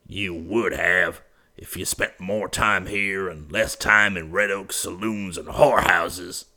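put-on slow, cutting old rancher retort; gruff retort. A narrator's reading. Bryant retorts to his nephew's excuse. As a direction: throw it back at him.

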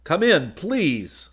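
high, slow, pleading call into the radio; desperate plea. A narrator's reading. Solo on the walkie-talkie, calling the bomber, desperately pleading. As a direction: beg the silent set to answer, drawn out. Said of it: "letting desperation enter his voice".

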